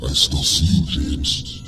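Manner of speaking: low, loud, distorted menace; distorted voice changer. A narrator's reading. Masked stranger speaking through the laptop speakers, distorted voice. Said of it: "masking his voice with some kind of voice changer".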